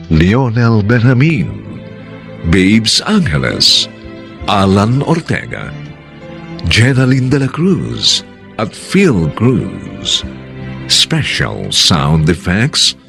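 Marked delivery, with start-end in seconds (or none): none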